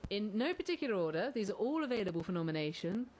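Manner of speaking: ordinary delivery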